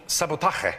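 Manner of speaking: Sabotaje said the Spanish way; Spanish pronunciation. Shown as a demonstration. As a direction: say Sabotaje as a Spanish word, rasped j. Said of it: "that's their word for sabotage".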